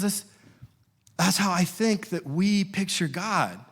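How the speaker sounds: ordinary delivery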